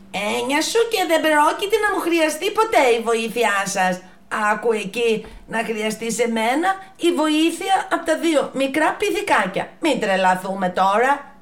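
high, loud haughty giraffe; scoffing. Acted. The giraffe mocking the little monkeys, high and loud. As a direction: sneer at the idea of help.

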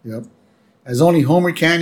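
ordinary delivery

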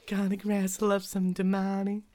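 mock cowboy roundup; Texas accent. Co-host brings back the Texas accent joke. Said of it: "it's supposed to have a Texas accent".

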